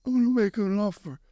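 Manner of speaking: lightly voiced mafia don; bad Godfather impression. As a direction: ham up the Godfather line. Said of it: "That was terrible acting".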